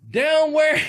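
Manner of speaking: loud, high, baffled "down where?"; a loud high confused question; puzzled. Retold or imitated. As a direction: blurt it out, genuinely confused. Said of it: "I say".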